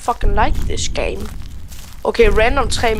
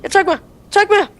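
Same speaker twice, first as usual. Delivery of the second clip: scared; 8 semitones higher, much faster than usual. Real, not acted. Scared player giving up.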